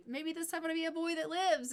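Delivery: high, cheerful dark joke; hopeful husband. Acted. Husband joking to his wife about the baby, high and bright. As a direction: say it brightly despite the grim joke.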